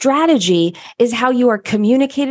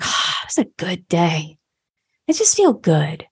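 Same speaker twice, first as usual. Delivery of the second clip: contented whisper. Acted. Voicing a satisfied generator's feeling.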